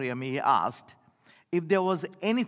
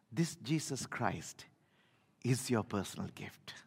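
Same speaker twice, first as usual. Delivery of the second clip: breathy hushed whisper, much softer; 7 semitones lower, 10 dB quieter than usual. Real, not acted. Preacher whispering the good news to the congregation. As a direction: lean in and whisper it to the church. Sermon climax, hushed aside.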